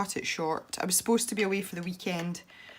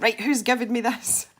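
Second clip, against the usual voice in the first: indignant; 5 semitones higher, 7 dB louder than usual. Retold or imitated. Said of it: "I'm like".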